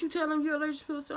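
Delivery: high, fast worried mother; worried mom voice. Retold or imitated.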